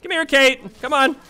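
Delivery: calling. Real, not acted. Coaxing Kate over, high calling.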